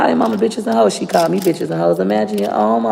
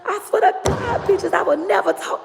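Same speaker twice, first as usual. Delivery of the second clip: high-pitched scolding grandma voice, much higher; imitating grandma Johnny Mae; 12 semitones higher than usual. Granddaughter mimics grandma forbidding the call, high scolding voice. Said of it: "my grandma Johnny Mae said".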